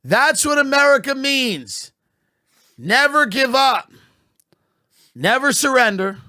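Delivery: mock-earnest patriotic rally cry; mock patriotic speech. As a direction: belt it like a stirring anthem speech while laughing through it.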